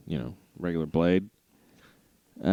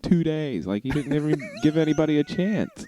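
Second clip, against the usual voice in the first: high-pitched, aggrieved complaint in jest; mock indignant protest; 10 semitones higher, 4 dB louder than usual. Acting out complaint to the seller.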